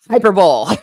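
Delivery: hyperbole said loud as "HY-per-bowl"; gleefully defiant three-syllable hyperbole, rhyming with bowl; teasing pronunciation. Shown as a demonstration. Triumphantly answers own teasing question.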